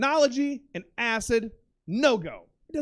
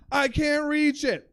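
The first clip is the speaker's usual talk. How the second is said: helpless whine; 5 semitones higher, 3 dB louder, slower than usual. Retold or imitated. Retells struggling for dropped tablet.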